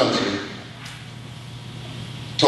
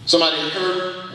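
usual speaking voice

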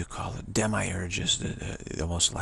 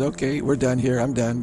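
louder, curt brush-off; mock dismissal; 3 semitones higher, 8 dB louder than usual. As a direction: shut the press down loudly. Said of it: "he says".

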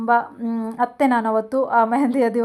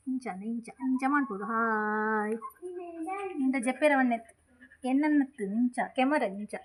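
soft pet coaxing; 8 dB quieter than usual. Real, not acted. Owner softly coaxing her dog to greet the camera, quiet cooing.

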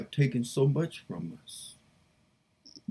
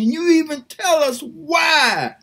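anguished cry, much higher and louder; crying shout; 11 semitones higher, 8 dB louder than usual. Real, not acted. Woman praying to God, breaking into an anguished crying shout.

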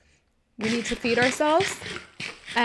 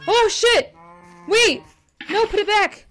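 startled; 8 semitones higher, 5 dB louder than usual. Real, not acted. Panics at the fountain going wrong.

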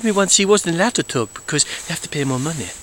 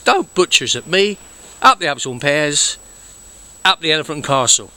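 put-on Cockney accent. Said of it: "I can do the Cockney's accent".

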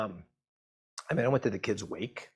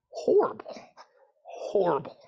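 a high, cracking, broken cry on the word; real grief catching in the throat; voice breaking; 10 semitones higher, 4 dB louder, slower than usual. Real, not acted. Recalling the boy's death.

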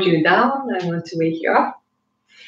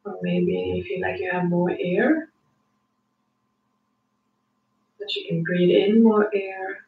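guided meditation; 4 dB quieter than usual. Acted. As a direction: talk them gently into calm. Guiding a calm breathing meditation.

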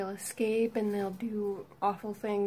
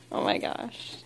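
whispered; 13 semitones lower, 4 dB quieter than usual. Real, not acted. Amazed at pet, low voice.

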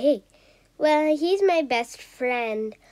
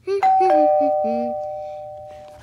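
laughing; 5 dB louder than usual. Real, not acted. Laughing before her bath.

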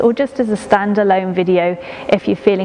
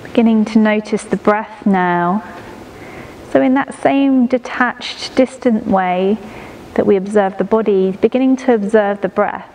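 guided meditation. Acted. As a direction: ease the listener into stillness, breath by breath. Leads a prenatal body-scan meditation.

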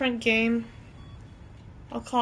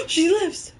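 surprised gasp; 7 semitones higher, 4 dB louder than usual. Real, not acted. Character wakes up.

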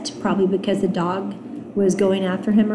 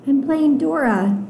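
put-on higher child voice; little girl's protest; 6 semitones higher than usual. Nunu answering her scolding brother, childlike protest. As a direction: answer back in a high little-girl voice.

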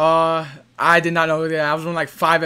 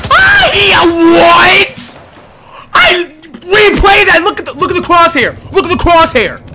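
disbelief; 6 semitones higher, 5 dB louder, slower than usual. Real, not acted. Disbelief at missed shots.

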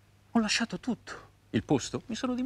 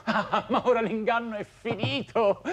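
excited words launched with a laugh; triumphant laughing; 5 semitones higher than usual. Acted. Lover announces to Flora the deception is over, triumphant laughing. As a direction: laugh with release as you declare it.

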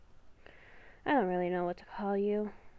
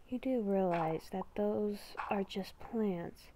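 beast within voice; 4 semitones lower, 9 dB quieter than usual. Acted. Inner beast whispering a warning to Haley, hushed and ominous. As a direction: whisper like a creature living inside her head.

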